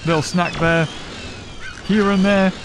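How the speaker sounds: usual speaking voice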